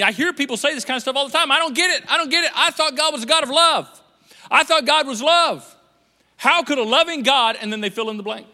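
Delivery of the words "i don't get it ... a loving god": high, loud mock complaint; hammed-up frustrated churchgoer; complaining believer. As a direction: whine it like a baffled believer.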